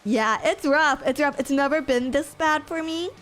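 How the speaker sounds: usual speaking voice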